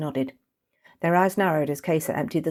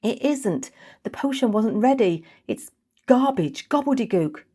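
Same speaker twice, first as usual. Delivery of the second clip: anxious dismay; 7 semitones higher, 4 dB louder than usual. Acted. Kesa explaining failed potion.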